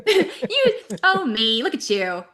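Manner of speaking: high, loud, through laughter; laughing self-address. Acted. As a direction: laughingly coo at yourself on screen. Joins joke, addressing herself on screen.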